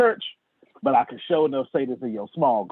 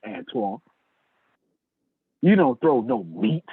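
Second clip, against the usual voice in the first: ordinary delivery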